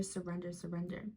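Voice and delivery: fading quiet repetition; fading to hush. Acted. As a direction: let each surrender fall away. Fading repeated word.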